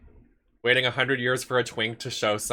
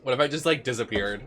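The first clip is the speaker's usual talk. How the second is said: normal talking voice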